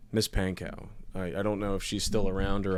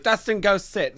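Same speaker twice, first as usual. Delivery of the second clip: stern teacher; 10 semitones higher, 5 dB louder than usual. Retold or imitated. Teacher voiced in a story.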